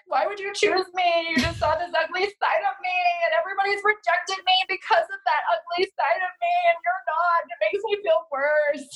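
mock her own insecure pleading; whiny insecure self. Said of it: "I even had moments of like".

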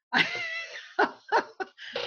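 high, bright burst of real laughter; real amused laugh. Real, not acted. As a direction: laugh out at her own joke. Laughs at own punchline.

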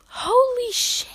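high, drawn-out startled cry; startled yelp. A narrator's reading. Runner startled by Katsuki's small explosions, acted high yelp. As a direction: yelp it high and slow, hammed-up. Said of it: "you yelped".

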